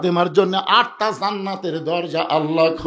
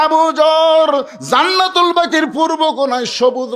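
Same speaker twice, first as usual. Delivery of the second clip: high, loud chanted lament; acted tearful outcry; 10 semitones higher, 5 dB louder than usual. Ali telling Abu Zar of the green light, tearful outcry. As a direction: answer a friend in a high cry. Weeping Ali answers his friend.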